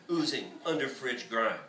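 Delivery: plain reading voice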